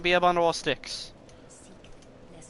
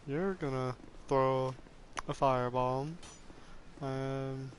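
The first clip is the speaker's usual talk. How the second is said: softer, strange put-on character voice; odd put-on voice; 7 dB quieter than usual. Real, not acted. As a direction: talk it like some weird frustrated character. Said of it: "I don't know why I'm talking like that now".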